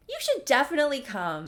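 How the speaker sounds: high, loud and quick urging; hammed-up insistence on coming along; over-eager invitation. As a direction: push the invite too brightly.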